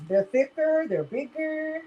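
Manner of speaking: usual speaking voice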